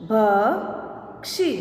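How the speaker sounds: slow, each sound drawn out, rising at the end; slow drawn-out reading. Shown as a demonstration. Teacher slowly sounding out a word for students, drawn out. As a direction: stretch the word slowly for the class. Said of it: "अब इसका शब्द हम देखें".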